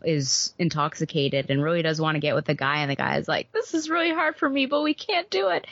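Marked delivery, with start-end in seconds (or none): 3.5-5.7 sincere guy voice. Retold or imitated. Guy in romance novel declines.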